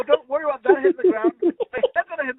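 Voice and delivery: frantic retold warning; retold yell. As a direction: scold the kid from the ladder. Yelling at kid on ladder.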